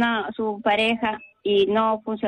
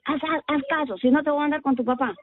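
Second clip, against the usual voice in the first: annoyed mother; 5 semitones higher, faster than usual. Retold or imitated.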